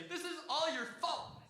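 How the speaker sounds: high-pitched, drawn-out blame; dramatic accusation. Retold or imitated. Kid blaming baby sister for the open door, dramatic. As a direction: play up a dramatic kid's accusation. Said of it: "I was a little bit dramatic".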